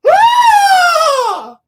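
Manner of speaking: long, very high, loud scream; shrill sustained "aaah"; imitated scream. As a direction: imitate a distant victim's scream. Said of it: "and you just hear".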